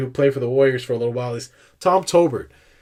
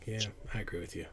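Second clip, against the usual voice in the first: fast, breathless whisper, much softer; whispered aside; 5 semitones lower, 19 dB quieter, much faster than usual. Real, not acted. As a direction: lean in and agree under your breath.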